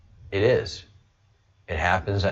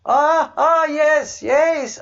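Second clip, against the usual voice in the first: much higher put-on Indian accent, louder; hammed-up Indian accent impression of a mentor; bad Indian accent; 4 dB louder, much slower than usual. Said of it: "I do a horrible Indian accent".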